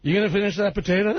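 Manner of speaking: quick mumbled [name] line; [name] impression. [name] asks the fan for her potato, in a fast mumble. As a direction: toss it off like hungry [name]. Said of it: "[name] is on stage".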